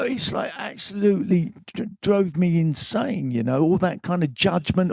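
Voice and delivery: normal talking voice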